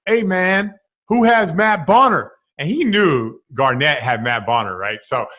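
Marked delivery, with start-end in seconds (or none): 0.1-2.3 teammate calling out the room at halftime, mock demanding; imitating a teammate calling out. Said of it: "It's like".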